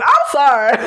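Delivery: usual speaking voice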